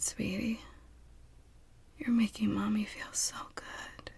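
ordinary talk, nothing marked

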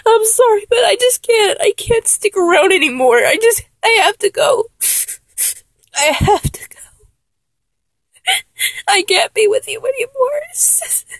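high, tearful voice breaking into sobs; crying. Acted. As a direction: beg through the tears, voice cracking. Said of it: "you see me crying".

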